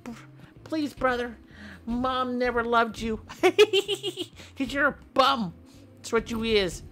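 high, squeaky bratty sibling taunt; high-pitched mock brother, laughing through it. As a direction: whine and taunt like a kid brother. Brother fight voice acted.

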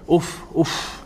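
breathy soft whispered "Uff, uff". Shown as a demonstration. Imam showing the sigh the Prophet never made, whispered.

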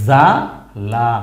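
জালা stretched very slowly with a rise at the end; drawn-out reading. Shown as a demonstration. Teacher to learners, stretching জালা very slowly. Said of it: "তাহলে আমরা প্রথম লাইনে কি পেয়েছি?".

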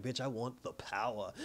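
low, soft sneering villain; quiet menacing villain reply; cold villain. Retold or imitated. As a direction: drop it low and hush it like a smug villain.

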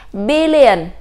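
billion with BIL stressed and a falling end; stress on first syllable. Shown as a demonstration. Teacher drilling billion with BIL stressed. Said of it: "Chúng ta cũng nhấn âm đầu".

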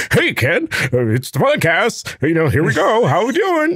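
put-on high, peppy podcast greeting; fake excitement. Podcaster faking a switch flip to greet co-host, falsely excited. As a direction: pretend to be thrilled, pitch jumping up. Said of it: "pretend to be all excited".

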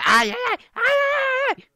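cartoonish cries of being pricked; mock pain cries. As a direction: wail in exaggerated pain. Hurt by a cactus in game.

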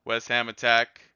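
ordinary talk, nothing marked

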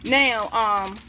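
ordinary delivery

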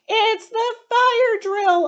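very high, loud slow excited cry; cried out. Acted. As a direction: announce it to the whole class, thrilled. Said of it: "Octopus cried".

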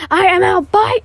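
triumphant yell; shout. Acted. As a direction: yell it as you bolt. Character escaping loudly.

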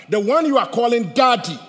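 loud, high fervent preaching; rising pulpit fervor; preaching at full force. Acted. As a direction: drive it home to the congregation. Preaching builds to climax.

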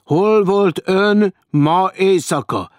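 slow, loud, high-pitched interrogation; grim accusation. A narrator's reading. Stranger interrogates the woman, grimly accusing. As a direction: hammer out the question like an accuser. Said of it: "és a hangja komor, sőt, vádló lett".

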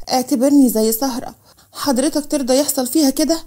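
ordinary delivery

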